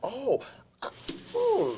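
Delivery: rising, soft mock hmm; mock pondering listener. Imitating a listener reacting to a joke, soft mock hmm. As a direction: act out an amused thinking listener.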